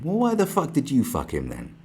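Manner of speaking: usual speaking voice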